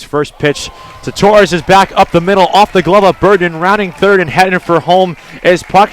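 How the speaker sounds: loud, rising call of the hit and run home; live softball call building up; excited play-by-play. Real, not acted. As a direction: call the play as it happens, pumped.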